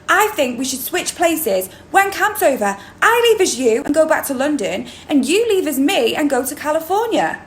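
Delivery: excited schemer, high with big pitch swings; Hallie. Acted. Hallie wakes her twin Annie with a plan to swap, excited and theatrical. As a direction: perform it like a thrilled child pitching a brilliant plan. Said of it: "I'm gonna read you the monologue now".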